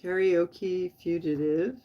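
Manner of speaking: spelling out "Karaoke Fugitive" slowly, word by word; slow and clear. Shown as a demonstration. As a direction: say "Karaoke Fugitive" very slowly so they can type it. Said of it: "I'm gonna just tell you his channel".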